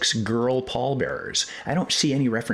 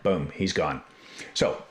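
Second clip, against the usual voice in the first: ordinary delivery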